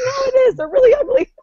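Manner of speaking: mocking a coworker's tearful protest; whiny coworker. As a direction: plead like a hurt believer. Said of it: "She's like".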